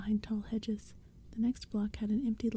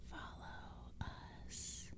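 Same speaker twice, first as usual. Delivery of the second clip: very soft, drawn-out creature hiss; hissing whisper; 18 dB quieter, much slower than usual. A narrator's reading. Pumpkinhead ordering the kids to follow, hissing in a whisper. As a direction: whisper it as an eerie summons. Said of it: "one of the Pumpkinheads hissed".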